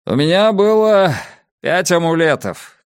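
high, loud call to the crowd; shout. A narrator's reading. Drakkainen addresses the crowd from the gallery, shouting. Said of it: "крикнул он".

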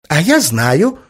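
put-on high, piping little girl's outburst; eager exclamation. A narrator's reading. Little daughter pipes up from the back seat, exclaiming. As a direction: blurt it out like a child who knows. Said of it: "воскликнула сзади Надя".

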